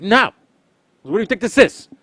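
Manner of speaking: high, loud exasperated no; angry snap. Retold or imitated. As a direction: snap back at the customer.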